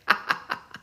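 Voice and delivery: quiet, voiceless laugh; amused laugh. Real, not acted. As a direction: let out a soft, breath-only laugh. Laughs after correcting the listener.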